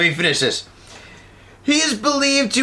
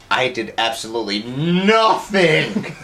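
Jedi mind trick; 7 semitones lower, slower than usual. Retold or imitated. Jedi mind trick voice, low slow.

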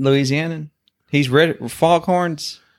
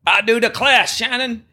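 scandalized Southern put-on, hammed up; Southern accent; 7 semitones higher than usual. Host mocking the leaky duck, high Southern accent. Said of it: "supposed to be doing accents".

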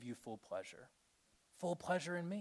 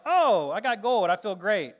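mock gold medal joy; 5 semitones higher, 10 dB louder than usual.

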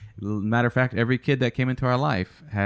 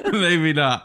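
higher-pitched words through laughter; real laughing reply; 6 semitones higher, 4 dB louder than usual. Real, not acted. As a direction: get it out while still laughing. Still laughing after the joke.

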